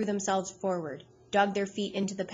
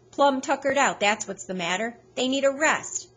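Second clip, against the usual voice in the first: folksy insistence; 6 semitones higher, 5 dB louder than usual. Acted. Onlooker argues dogs are tired.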